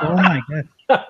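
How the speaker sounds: high, stretched-out oh my God; laughing, scandalized disbelief; shocked gasp. Retold or imitated. Shocked at outrageous opener.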